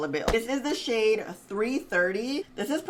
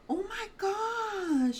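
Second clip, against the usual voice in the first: awed whisper; 12 semitones higher, 4 dB quieter, much slower than usual. Real, not acted. Stunned by her skin.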